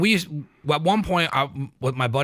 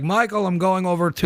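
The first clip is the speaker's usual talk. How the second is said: higher-pitched mock Sicilian accent; bad Sicilian accent; 5 semitones higher than usual. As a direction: call your friend like a hammy Sicilian. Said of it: "Sicilian accent".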